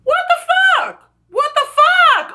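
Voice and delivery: slow, very high-pitched outrage; pissed off. Real, not acted. Fan reacting to Zoe's death, drawn-out squeals of outrage. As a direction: squeal each word out in disbelief. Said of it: "so pissed".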